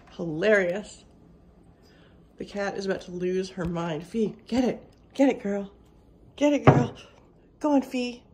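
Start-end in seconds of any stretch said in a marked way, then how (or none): 4.1-8.2 loud, punchy cheering-on; egging on. Real, not acted. Owner egging on her cat to get the squirrel, loud. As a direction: urge the cat on loudly.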